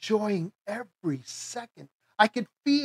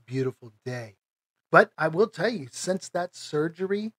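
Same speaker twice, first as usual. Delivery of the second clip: ordinary delivery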